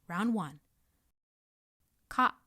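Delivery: usual speaking voice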